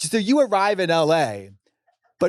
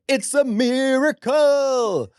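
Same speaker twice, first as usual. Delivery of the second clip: slow, higher drawn-out diva line; 9 semitones higher, much slower than usual. Retold or imitated. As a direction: stretch it out like a grand diva. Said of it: "her in the booth with me saying".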